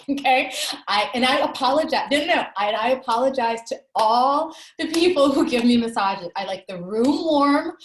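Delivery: an embarrassed laugh that spills into a loud apology. Real, not acted. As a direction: laugh it out as a guilty confession. Laughing confession about hot massage tables.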